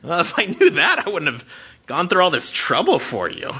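a high, loud, hammed-up startled God; lightly voiced God, shocked at a bad person; mock shocked God. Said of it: "God's going".

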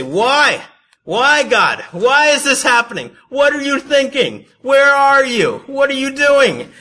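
very high, loud wailing questions; mock anguished cry. We blame God when bad things happen, mock wailing. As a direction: shout each question to heaven in hammed-up despair. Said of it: "we just go and we say".

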